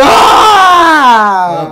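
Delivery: long, loud scream of doom; over-the-top death cry; panicked scream. Pretend. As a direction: stretch it into a wailing yell. About to lose in the game.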